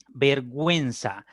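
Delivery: "vergüenza" slowly as ver-gwen-sa; sounded U. Shown as a demonstration. Teacher shows students the dieresis at work, U sounded slowly. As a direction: stretch "vergüenza", W glide clearly heard. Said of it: "en vergüenza la U sí se pronuncia".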